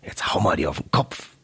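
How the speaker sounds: hushed, breathy invitation to party; quietly egging a friend on; hushed quote. Retold or imitated. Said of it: "hast du irgendwie noch gemeint". (leises, verschwörerisches Zuraunen)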